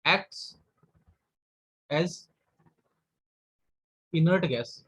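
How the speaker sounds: dictating with long gaps between words; slow dictation. Shown as a demonstration. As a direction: give each word time to be written. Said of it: "dictating notes with long pauses". (रुक-रुककर लिखवाते हुए)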